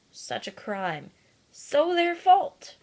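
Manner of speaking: mocking, last words high, loud and slow; sarcastic. Vlogger mocking people who blame animals, sarcastic jab. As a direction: scoff at the idea it's their fault.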